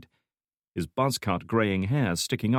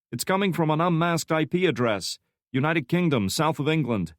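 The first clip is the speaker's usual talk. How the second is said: lightly voiced young analyst, higher; young analyst Santiago; 8 semitones higher than usual. A narrator's reading. Young analyst reporting to his boss, lightly higher voice. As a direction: report it briskly, eyes on screen.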